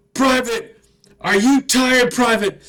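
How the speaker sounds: high, loud barking sergeant; drill sergeant yell. Retold or imitated. Drill sergeant yelling at a recruit who looked down. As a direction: bark it like a drill instructor.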